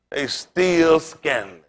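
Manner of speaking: slow drawn-out verdict. Acted. Drawn-out punchline.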